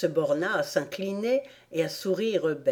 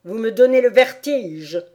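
loud, higher irritated complaint; imperious queen; 4 semitones higher, 8 dB louder than usual. A narrator's reading. Queen tells off bowing gardeners, loud and irritated. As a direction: complain loudly like an annoyed queen.

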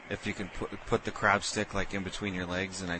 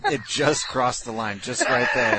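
giddy; 5 semitones higher, 10 dB louder than usual. Real, not acted. Laughing after prank call.

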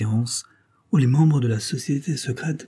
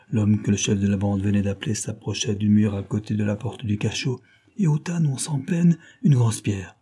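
usual speaking voice

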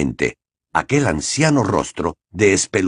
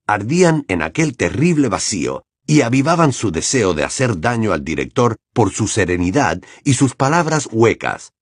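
plain reading voice

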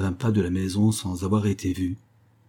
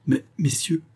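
higher-pitched cry of protest; exclaiming lackey; 8 semitones higher than usual. A narrator's reading. Lackey objects to men taking the horses, exclaiming. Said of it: "s'écria le laquais".

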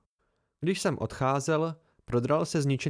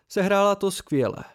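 enthusiastic praise; 6 semitones higher, 5 dB louder than usual. Acted. Simon praises loudly.

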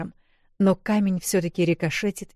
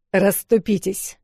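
commanding order; 2 semitones higher, 5 dB louder than usual. A narrator's reading. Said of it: "приказ".

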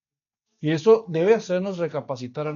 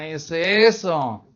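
ordinary delivery

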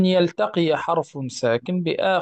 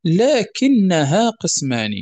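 slow, louder, measured quoting of verse; verse recitation; 3 semitones higher, 5 dB louder, slower than usual. Acted. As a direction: recite the didactic poem line deliberately. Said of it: "ثم قال". (إلقاء بيت من المنظومة ببطء وبصوت أعلى)

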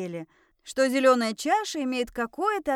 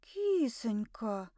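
slow, soft, stretched-out pet name; whispered; 5 dB quieter, much slower than usual. A narrator's reading. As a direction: breathe the word out softly and slowly. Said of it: "прошептала я".

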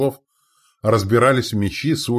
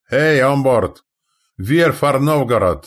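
high, loud hail in Danish; ringing masthead shout; 7 semitones higher, 7 dB louder than usual. A narrator's reading. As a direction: call across the water to another ship. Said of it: "прокричал с мачты на родном языке Трюгве".

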